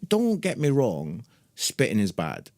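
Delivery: usual speaking voice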